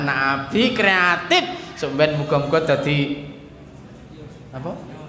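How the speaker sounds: usual speaking voice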